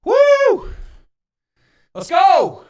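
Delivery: loud excited cheer; hype shout. Real, not acted. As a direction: yell it out, pumped up. Hyped cheer on stream.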